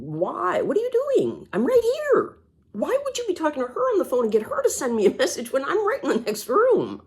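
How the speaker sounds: replaying her own upset outburst at mom; exasperated confrontation. Daughter retelling how she confronted her mother, exasperated and high. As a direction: pitch leaping high in retold disbelief.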